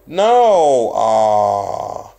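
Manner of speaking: sudden loud cry of dismay; a real yelp of frustration, then a groan; frustrated outcry. Real, not acted. Realizes he forgot password.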